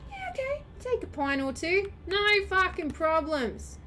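mock friendly donor. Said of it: "we would be like".

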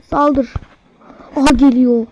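ordinary talk, nothing marked

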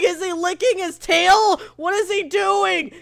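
usual speaking voice

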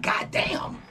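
breathy exasperated whisper of disbelief; mock whisper. Imagined reply to obsessive critic.

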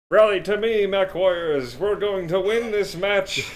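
rousing commander shout, high and loud; rally the troops like a space captain; in-character battle cry. Retold or imitated. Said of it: "and he's like".

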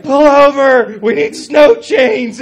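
loud, high, drawn-out panicked yell; imitated panicked wife. Imitating his wife afraid of his driving, panicked yell. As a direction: mimic a terrified passenger yelling at the driver. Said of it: "she is so afraid of me and my driving".

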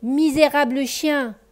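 mildly voiced furious panther. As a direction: panther shouting at the dog, mock fury. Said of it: "s'écria la panthère furieuse".

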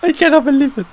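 very high squeal of disbelief. Real, not acted. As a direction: squeal it in shock. After the win.

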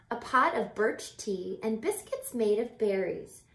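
usual speaking voice

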